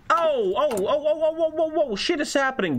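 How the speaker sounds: high, rolling string of ohs; startled reaction. Real, not acted. Streamer telling the boys shit is happening, startled and high. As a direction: sound the alarm, high-pitched and stunned.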